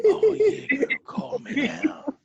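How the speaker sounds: high, loud, slow teasing come-on; mock hotline come-on. Host mocking the guest as a late-night hotline voice, high and slow. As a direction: ham up a psychic hotline ad.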